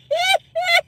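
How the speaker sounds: short high squeak of laughter. Real, not acted. Laughing at the viewer's nose trimmer gift. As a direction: let a high giggle slip out.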